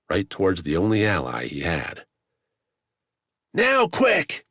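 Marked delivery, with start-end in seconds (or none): 3.5-4.5 loud, high urgent shout. A narrator's reading. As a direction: call out sharply for help. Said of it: "The shout".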